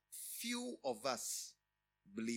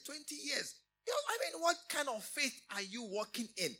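loud, higher taunting challenge to a man of faith; mocking doubter; 11 semitones higher, 6 dB louder than usual. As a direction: jeer at him for waiting so long.

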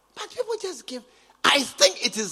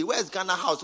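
ordinary talk, nothing marked

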